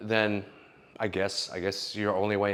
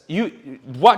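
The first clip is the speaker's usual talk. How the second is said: ordinary talk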